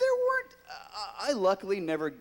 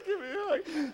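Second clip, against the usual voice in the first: much higher, louder, tearful relief; relieved near-tears; 4 dB louder than usual. Retold or imitated. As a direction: beg for comfort. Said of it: "And I'm like".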